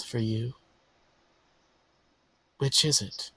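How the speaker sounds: soft, insinuating villain voice; coaxing villain. Acted. Villain tempting the player to bargain for the child, soft coaxing. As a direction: coax softly, with pauses between phrases.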